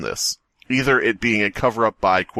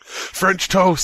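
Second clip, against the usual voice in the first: mocking imitation; 9 semitones higher than usual. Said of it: "Why did you say French toast".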